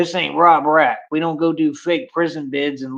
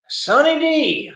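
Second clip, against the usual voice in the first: drawn-out Sunny D; 12 semitones higher, much slower than usual. Real, not acted. Savoring drink on stream.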